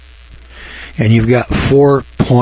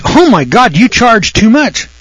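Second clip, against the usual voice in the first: mock outraged customer, pitched high; indignant customer; 10 semitones higher, 3 dB louder than usual. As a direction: imitate a shocked customer protesting the price. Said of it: "they get really indignant".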